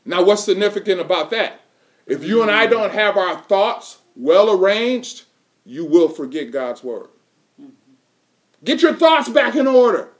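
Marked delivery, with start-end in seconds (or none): none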